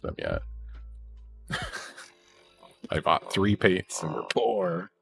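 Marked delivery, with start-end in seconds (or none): none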